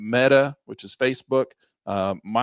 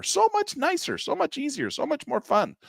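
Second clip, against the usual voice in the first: excited. Real, not acted. Enthusing about new tools.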